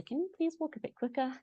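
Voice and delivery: high, soft mock request; imagined polite plea. Acted plea to slow walkers.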